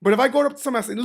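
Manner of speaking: ordinary talk, nothing marked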